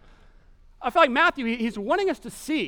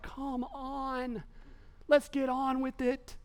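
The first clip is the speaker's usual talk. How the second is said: soft, higher impatient moan; exasperated disciples; 4 semitones higher, 5 dB quieter, much slower than usual. Acted. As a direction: plead under your breath, fed up with waiting. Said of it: "shaking their heads".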